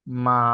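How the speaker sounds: মা held low in the voice; third tone. Shown as a demonstration. Teacher reading the four tones in order, low third tone. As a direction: sink low on a drawn-out মা. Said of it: "আবার সবার বোঝার সুবিধার্থে".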